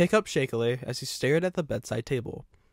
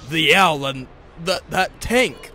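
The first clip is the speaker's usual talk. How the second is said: loud, high, halting insistence; rattled stammer; 4 semitones higher, 10 dB louder than usual. A narrator's reading. Shaken man questioning the engine, loud rattled stammer. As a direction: blurt it out and trip over the words. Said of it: "he said as he stammered back some more".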